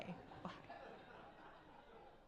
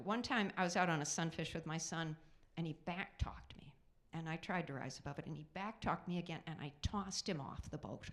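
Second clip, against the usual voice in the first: usual speaking voice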